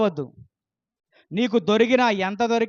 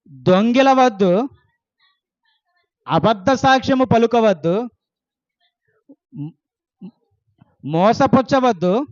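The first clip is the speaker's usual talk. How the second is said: slow, louder, each command hammered out; emphatic commandment reading; 5 dB louder, slower than usual. Acted. Pastor proclaiming the commandments to his congregation, emphatic and louder. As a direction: proclaim every commandment like a law.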